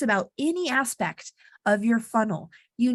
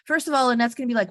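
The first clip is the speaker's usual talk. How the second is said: normal talking voice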